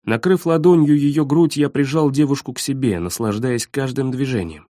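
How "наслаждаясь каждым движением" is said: plain reading voice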